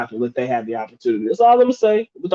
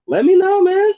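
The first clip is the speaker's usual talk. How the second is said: high squeak. Retold or imitated. Reading a viewer's comment aloud.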